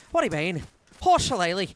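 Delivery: a loud, hammed-up Irish accent; a bad Irish accent, shouted; mock Irish accent. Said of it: "racist Irish accent".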